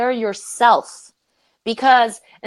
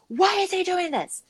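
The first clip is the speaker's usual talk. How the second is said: high, softer indignant wail, imitated; upset complaint; 11 semitones higher, 4 dB quieter than usual. Employee inwardly protesting the manager's outburst, upset high-pitched complaint. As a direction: act out a wounded protest, pitch way up. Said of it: "I wanted to be upset".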